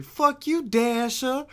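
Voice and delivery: high, loud mock British-Boston accent; mixed British-Boston accent. British homegirl picking on Dasher, high mock British-Boston accent. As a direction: mimic a Brit who lived in Boston. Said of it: "That's how she talks".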